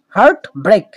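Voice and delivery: two equal, separate beats on heart break; both syllables stressed. Shown as a demonstration. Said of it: "দুটা কিন্তু stressed হবে".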